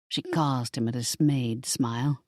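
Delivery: ordinary delivery, nothing marked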